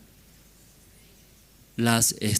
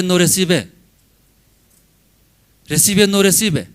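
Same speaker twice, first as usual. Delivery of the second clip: no recibe called out louder; 5 semitones higher, 8 dB louder than usual. Shown as a demonstration. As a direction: punch the refusal. Contrast loud and soft repeat.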